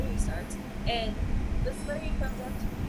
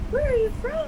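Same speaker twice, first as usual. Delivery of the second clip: much higher and louder, astonished; mock-surprised shopper; 13 semitones higher, 5 dB louder than usual. Clerk shocked by a Texan's accent, high and loud. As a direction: play the amazed grocery clerk, pitched way up. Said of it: "She's like".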